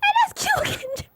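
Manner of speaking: very high, drawn-out squeaky voice; retold awkward high-pitched remark; starstruck squeak. Retells her awkward starstruck remark.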